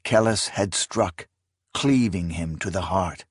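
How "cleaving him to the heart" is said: plain reading voice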